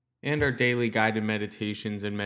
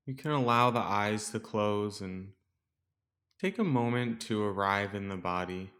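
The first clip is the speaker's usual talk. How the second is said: calm, softer meditation voice with long pauses; guided meditation; 5 dB quieter than usual. Real, not acted. Teacher guiding listeners to settle into the breath, calm meditation voice. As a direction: guide listeners gently into stillness, leaving space between phrases.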